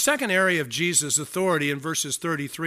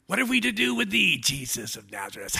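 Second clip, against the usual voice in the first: creepy demon; 3 semitones higher than usual. Shown as a demonstration. Preacher mocks up the demon addressing Jesus, creepy demon voice. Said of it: "it should be like a creepy demon voice".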